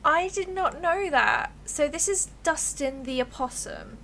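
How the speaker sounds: ordinary talk, nothing marked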